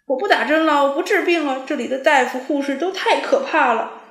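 put-on a terrified boy yelling at the clinic; desperate yelling. A narrator's reading. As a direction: howl that you won't have the shot. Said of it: "拼命叫唤起来".

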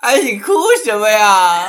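teasing through laughter; laughing. Real, not acted. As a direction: laugh while asking.

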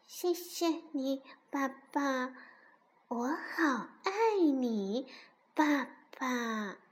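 soft, slow, drowsy murmur; sleepy duckling. A narrator's reading. Duckling nestles under fox's paw, drowsy soft thanks. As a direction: drift off while saying it, very softly. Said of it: "睡眼朦胧地说".

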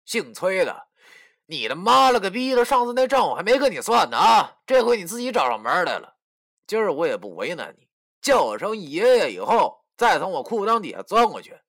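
bully him from on high; 嚣张的威胁; arrogant threat. Acted. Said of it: "十分猖狂的跟我说".

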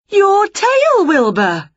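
high, loud excited cry; high shout falling on the name; sudden realisation. A narrator's reading. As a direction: exclaim it as it dawns on you. Witch suddenly remembers, cries out.

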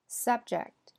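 subject stressed on SUB, falling; noun stress. Shown as a demonstration. Teacher repeats the noun, stress on SUB. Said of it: "前面是名词".

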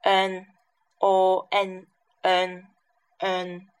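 O N N drawn out slowly, each sound held apart; slow sound drill. Shown as a demonstration. Teacher modelling the vowel to nasal glide for learners, slowly. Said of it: "先发那个O E O".

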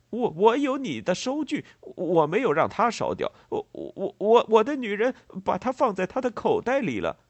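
high, loud, stammering farmer voice; agitated, confused farmer. A narrator's reading. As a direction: insist excitedly, tripping over "我". Confused farmer insists on his purchase. (农人激动结巴地高声争辩)

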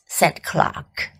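usual speaking voice

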